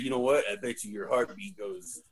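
low, very soft imitated threat; quiet quoted threat. Fan quoting the film's judge, quiet low threat. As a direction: drop low and quiet as you quote him.

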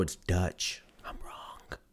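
real whispered admission. Real, not acted. Podcaster quietly admitting his geography mistake, whispered. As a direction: breathe it out, embarrassed.

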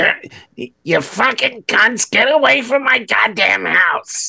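high-pitched screechy rant; Gilbert Gottfried. Pretend. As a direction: squawk insults at the neighbors. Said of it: "I'm doing this weird high-pitched voice".

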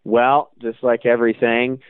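normal talking voice

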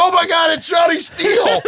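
high, loud laughing shout; excited fan. Retold or imitated. Retelling how Charlie greets the cop, excited shout. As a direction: blurt it like a starstruck fan.

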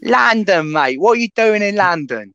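loud, put-on London accent; London accent, cheeky and loud. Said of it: "You sound like you're from London".

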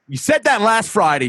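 put-on snappy New York landlord accent. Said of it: "New York accent".

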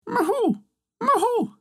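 very high falsetto owl hoot; screech owl imitation. Old woman hiding in the ivy, imitating an owl's cry. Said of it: "scream like an owl".

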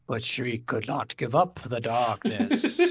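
slow, low narrator; game narrator voice. Acted. Quoting the game's text to the co-host, mock narrator voice. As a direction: intone it slowly and low, in a put-on storyteller voice. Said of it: "it also says, like".

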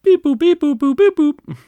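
high beeping phone-dial noises; toy robot voice. Pretend. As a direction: chirp the beeps like a toy robot. Dialing Japan on a phone.

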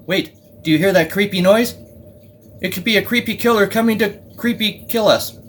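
rising high spooky tease; spooky storyteller. Pretend. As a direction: play up the creepy danger. Pretending to scare Henry.

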